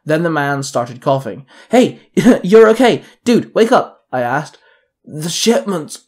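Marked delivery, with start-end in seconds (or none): 1.7-4.1 urgent call. A narrator's reading. Rousing a crushed man. 5.0-6.1 slow, weak croak. A narrator's reading. Trapped conductor croaking to rescuer. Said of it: "the man croaked".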